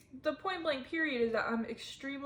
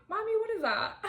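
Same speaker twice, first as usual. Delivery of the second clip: put-on high, innocent child asking mommy; little kid voice; 10 semitones higher than usual. Retelling childhood, voicing herself asking mom, high childlike. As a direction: play her younger self asking mom, wide-eyed.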